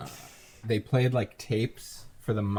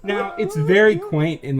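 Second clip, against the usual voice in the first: laughing; 10 semitones higher, 8 dB louder than usual. Real, not acted. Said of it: "In early spring".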